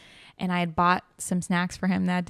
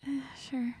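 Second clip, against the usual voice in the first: high, soft, hesitant retold reply; reluctant agreement; 7 semitones higher, 8 dB quieter than usual. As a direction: give in half-heartedly, quietly. Reluctantly agrees to ex's hug.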